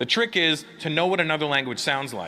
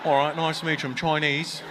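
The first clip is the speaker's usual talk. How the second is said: imitating Australian accent; 2 semitones lower than usual. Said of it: "never heard it quite put with that accent".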